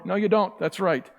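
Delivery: ordinary talk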